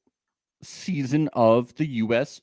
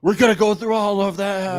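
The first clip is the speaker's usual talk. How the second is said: higher, excited declaration; hyped up; 6 semitones higher, 4 dB louder than usual. Real, not acted. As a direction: announce it with rising excitement. Hyped about playing every soundbite.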